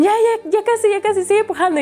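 high, eager cheering on; urging nurses. Retold or imitated. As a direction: coax her to keep pushing. Nurses urging her to push.

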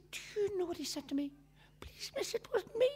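hushed scandalised whisper; a touch of a whispered mock boy. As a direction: whisper it as if shameful. Teacher tells the headmaster.

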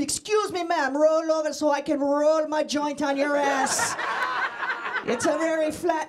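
put-on Jamaican street-guy accent. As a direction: ask politely in a comic Jamaican accent. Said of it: "Jamaican guys stop me on the street and ask me".

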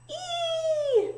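high, held squeal; shriek. A narrator's reading. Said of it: "shrieked Flap".

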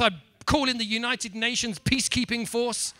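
ordinary delivery

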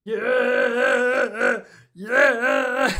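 high loud yelling yeah; cheering. Real, not acted. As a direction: cheer the win. Celebration cheer.

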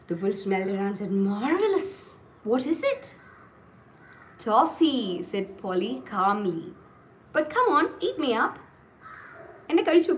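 animated, sing-song storyteller with acted dialogue; story reading to children. Teacher reading a wolf tale to children, expressive storytelling. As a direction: read it out expressively, leaning on key words.

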